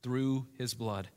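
low, soft and unhurried prayer. Real, not acted. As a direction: pray quietly to the Father, leaving pauses. Closes a reading in prayer.

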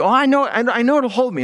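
a niece politely dodging the rocker; polite refusal. Retold or imitated. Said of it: "Ah, no".

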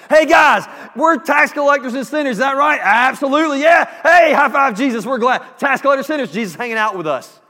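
high, loud, jubilant shouting to his friends; hammed-up Matthew whooping with glee; excited shout. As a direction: yell it out like a party host who just got great news. Matthew celebrating being called sinner.

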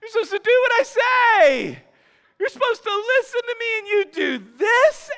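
high, loud mock-outraged telling-off; exasperated scolding. Owner scolding the guilty dog, high loud exasperation. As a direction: berate the dog in hammed-up disbelief. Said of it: "I was like".

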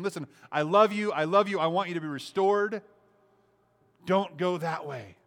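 high, earnest plea, slowing at the end; pleading love. Retold or imitated. God pleading with the wanderer, an earnest loving plea. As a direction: beg them tenderly to turn back.

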